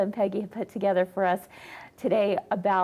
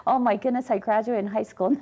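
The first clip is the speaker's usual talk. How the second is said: usual speaking voice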